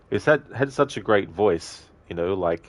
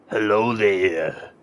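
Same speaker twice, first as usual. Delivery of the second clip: slow, louder gruff try at Arnie; Arnie impression; 2 semitones higher, 4 dB louder, much slower than usual. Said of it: "do the Arnie impression".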